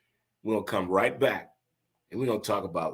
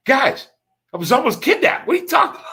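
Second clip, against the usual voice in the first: much higher, louder squeal of disbelief; mock outraged friend; 9 dB louder, faster than usual. Counselor telling friends he was almost kidnapped, squealing in disbelief. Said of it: "looked at us with a straight face and said".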